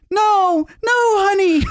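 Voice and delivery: retelling the proposal, mimics fiancée's high tearful no; imitating fiancée. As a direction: squeak it out high like her tearful refusal. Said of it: "And she says".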